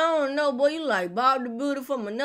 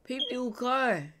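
usual speaking voice

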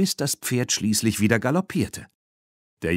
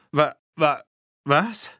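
higher, halting stammer of disbelief; dumbfounded stutter; 6 semitones higher, slower than usual. A narrator's reading. Erik hearing the bull is harmless, dumbfounded stutter. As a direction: stumble over the word in astonishment. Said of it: "vor lauter Verblüffung stotterte er".

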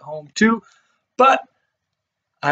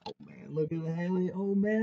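ordinary delivery

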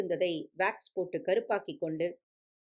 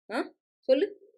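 pressing father; 7 semitones higher, 3 dB louder than usual. A narrator's reading. Father presses young man for answer.

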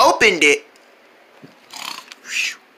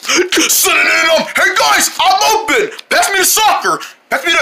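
calling out; 6 semitones higher than usual. Retold or imitated. Retold calling out during soccer.

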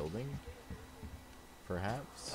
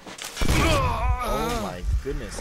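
shocked shout; 12 dB louder, much slower than usual. Real, not acted. Sudden explosion surprises player.

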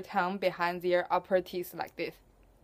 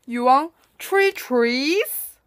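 auntie voice; 3 semitones higher, 8 dB louder, slower than usual. Acted. Auntie mishears customer.

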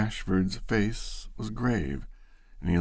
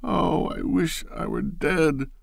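higher, tearful crying out; sobbing cry; 9 semitones higher than usual. Acted. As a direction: Loretta crying out between sobs to Bashford. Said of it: "from the midst of her sobs she cried".

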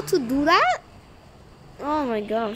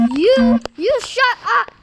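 sharp, loud and high: you, you shut up; annoyed outburst; 6 semitones higher, 6 dB louder, slower than usual. Real, not acted. Gamer snapping at an opponent who keeps fleeing, loud and annoyed. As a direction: snap it at the other player.

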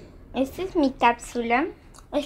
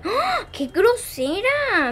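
surprised; 8 semitones higher, much slower than usual. Real, not acted. Rabbit does something rude.

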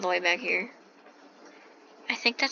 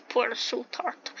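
ordinary talk, nothing marked

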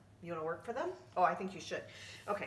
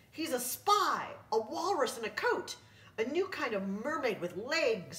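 pirate crew; 6 semitones higher, 4 dB louder than usual. Acted. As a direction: call out the wild guesses like rowdy pirates. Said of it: "said the men".

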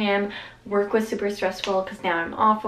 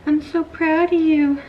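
higher, louder, slow doting praise to a puppy; gushing pet talk; 7 semitones higher, 5 dB louder than usual. Real, not acted. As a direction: coo it to the puppy, stretched out and loud.

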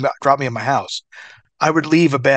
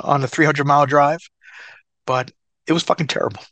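ordinary delivery

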